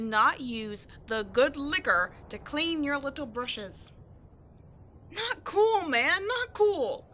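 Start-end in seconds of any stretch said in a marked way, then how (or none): 5.1-7.0 higher, louder, slow whiny protest; upset whine. Acted. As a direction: near tears over the good liquor. Upset over wasted liquor.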